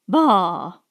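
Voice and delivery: scornful bah. A narrator's reading. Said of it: "in a tone that amazed both".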